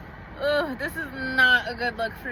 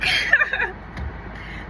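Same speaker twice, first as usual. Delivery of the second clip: breathy high laugh; 11 semitones higher than usual. Real, not acted. Laugh after a joke.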